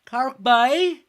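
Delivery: put-on a jokey high, loud Cork accent; mock Cork accent. Streamer putting on a Cork accent for chat, mock Cork accent. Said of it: "That Cork accent hurt".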